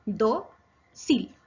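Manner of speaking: "Do-sil" in two drawn-out beats with a gap; teacher splitting "Do-sil" into syllables. Shown as a demonstration. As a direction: separate "Do" from "sil" with a clear pause. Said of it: "Se separa en dos sílabas".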